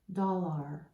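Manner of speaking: "dollar" with a full AR ending, like car; over-literal sounding out. Shown as a demonstration. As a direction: say "dollar" as spelt, doll-ARR. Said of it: "that doesn't make sense".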